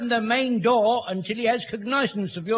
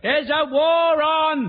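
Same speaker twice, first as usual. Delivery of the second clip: slow, raised, higher shout for the hard of hearing; loud repeat; 5 semitones higher, 5 dB louder, much slower than usual. Acted. Son to his old dad who missed it, loud slow repeat. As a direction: shout each word out slowly.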